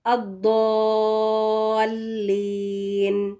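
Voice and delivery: الضالین with heavy "Daw" and very long held vowels; heavy Daad. Shown as a demonstration. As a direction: stretch الضالین slowly as in recitation. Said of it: "کیا ہوئے الفاظ؟".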